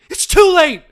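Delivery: high, loud shout; crying out. A narrator's reading. Daughter cries out at father, high and loud. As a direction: push him away with it. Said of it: "she cried".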